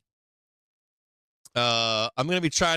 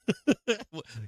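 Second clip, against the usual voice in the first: short laugh; 6 semitones higher than usual. Real, not acted. Laugh alone.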